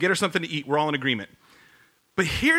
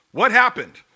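startled question; 4 semitones higher, 8 dB louder than usual. Retold or imitated. Jesus feels power leave him.

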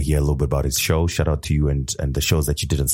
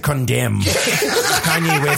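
slow, laughing mock corporate announcement; corporate statement voice; 8 semitones higher, slower than usual. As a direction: read it like a stiff press release. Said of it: "It's like V W saying".

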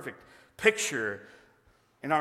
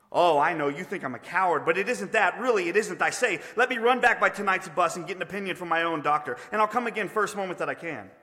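higher, louder, hurried self-justifying ghost; excuse-making ghost; 4 semitones higher, 5 dB louder than usual. Acted. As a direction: plead your way out of it. Ghost makes excuses to leave.

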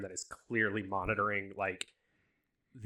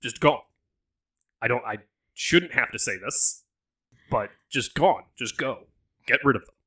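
stern Southern order, louder; southern accent; 2 semitones higher, 10 dB louder than usual. Acted. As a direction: lay down the law like a Southern dad. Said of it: "He got really southern".

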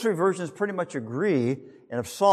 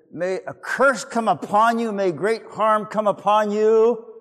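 higher, slow, solemn curse; cursing old man; 6 semitones higher, 4 dB louder than usual. Retold or imitated. Prophet cursing the children who mocked him, slow and solemn. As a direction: pronounce the curse slowly like a grim old prophet.

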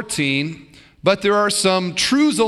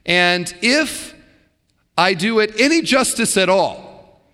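ordinary talk

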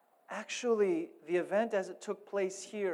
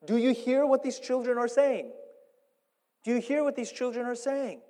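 a hint of indignant priests, pitched higher; 5 semitones higher, 5 dB louder than usual. Chief priests complaining to Jesus about children, mildly indignant. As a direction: lightly voice the scribes' complaint to Jesus.

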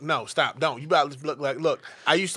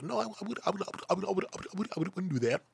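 higher, stammering mock nervous kid; stammering boyfriend; 7 semitones higher, 4 dB quieter, faster than usual. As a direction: stutter like a scared boyfriend. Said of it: "And he was like".

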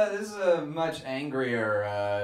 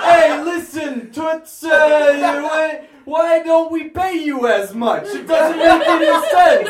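higher, louder brassy Bronx shout; Bronx accent; 9 semitones higher, 8 dB louder, slower than usual. Acted. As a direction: belt it like a loud Bronx dame. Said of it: "the feminist from the Bronx".

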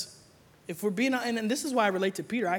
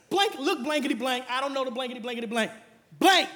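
mock cussing; 5 semitones higher, 3 dB louder than usual. Peter cussing, bleeped out.

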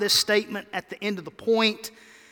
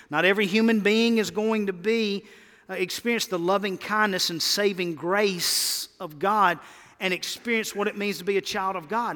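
usual speaking voice

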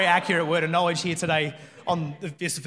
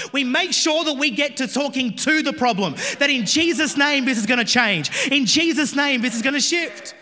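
louder, rising preacher's proclamation; preaching declaration; 6 semitones higher, 5 dB louder than usual. Acted. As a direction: declare it over the crowd.

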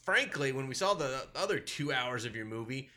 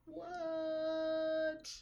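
stunned what; 5 dB quieter than usual. Retold or imitated. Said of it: "I was like".

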